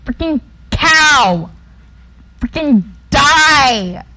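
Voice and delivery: frustrated yell. Real, not acted. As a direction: yell at the cow. Attacked by cow.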